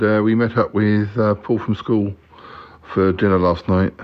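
ordinary delivery

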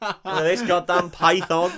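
high, loud, drawn-out brag; hammed-up boast, slow and loud; mock boast. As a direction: crow it slowly as a comic impression. Acted boast from retold film.

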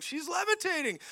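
high-pitched alarmed imitation; mock panic. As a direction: squeal it as a frantic onlooker. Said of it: "and they're like".

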